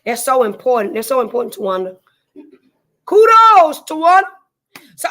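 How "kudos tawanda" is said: high, loud, delighted call to a viewer; bright excited shout-out. Real, not acted. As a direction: sing out her name with joy.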